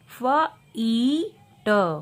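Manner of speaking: फ, ई, ट high, loud and separate; sounding out. Shown as a demonstration. Teacher showing double E, sounding out फ, ई, ट. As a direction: punch फ, long ई, ट one at a time. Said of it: "ई का long sound".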